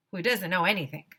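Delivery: usual speaking voice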